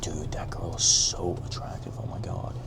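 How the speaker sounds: hushed whisper so she can't hear. Real, not acted. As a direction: breathe it out unvoiced, real hush. Hushed so nearby girl won't hear.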